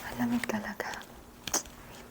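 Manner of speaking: soft unvoiced whisper. Real, not acted. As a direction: whisper so no one wakes. Quiet whisper in the cold.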